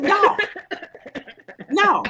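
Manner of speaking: loud scolding no; sharp loud no to a dog; firm no. Retold or imitated. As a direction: shout it at a misbehaving dog.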